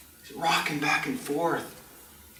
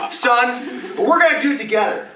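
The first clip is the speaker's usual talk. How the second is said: loud, high, fervent dad voice; obsessed dad; 8 semitones higher, 7 dB louder than usual. Acted. Dad telling his son he'll race, fervent. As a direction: drive it home to the kid.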